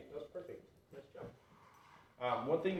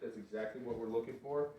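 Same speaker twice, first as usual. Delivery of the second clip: ordinary talk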